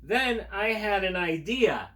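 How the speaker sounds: slow, pointed elephant voice; lightly voiced Gerald, drawn out; Gerald's voice. Reading aloud to kids, a lightly voiced elephant idea. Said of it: "when he said".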